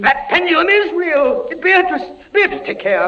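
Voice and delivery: high, loud panicked warning; frightened warning. Acted. Prisoner warning Beatrice about the pendulum, panicked. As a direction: warn her in rising panic.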